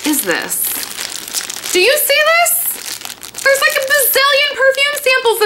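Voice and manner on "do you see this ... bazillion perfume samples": amazed. Real, not acted. Showing the box, amazed.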